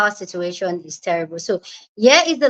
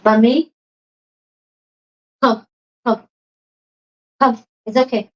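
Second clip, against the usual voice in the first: tender. Real, not acted. Comforting sick dog.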